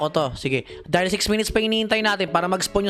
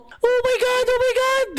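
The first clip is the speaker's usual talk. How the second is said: shocked shriek. Real, not acted. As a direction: shriek in disbelief. (gulat na tili)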